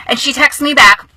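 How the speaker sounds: ordinary talk, nothing marked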